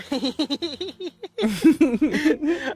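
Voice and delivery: laughter. Real, not acted. Continued laughter.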